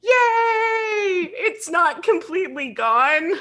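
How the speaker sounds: high, drawn-out squeal of joy; delighted, high-pitched celebration; gleeful cheer. Real, not acted. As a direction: cheer it in a high, happy wail. Celebrates keeping some Canadian accent.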